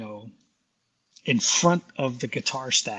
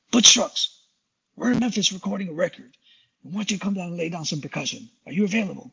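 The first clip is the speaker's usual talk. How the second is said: [name] voice; 6 semitones higher than usual. Retold or imitated. [name] phoning Mark to invite him to Memphis, booming. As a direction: call him up booming like the drummer. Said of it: "I'm gonna do it in the voice of [name]".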